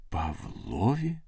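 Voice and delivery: slow, soft, disbelieving rise; moaned question. A narrator's reading. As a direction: echo the name as a drawn-out, pained question. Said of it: "простогнав він".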